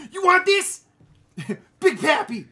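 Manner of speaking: put-on high, loud Boston accent naming Red Sox players; Boston accent, shouted; loud Boston fan. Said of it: "just naming Red Sox players".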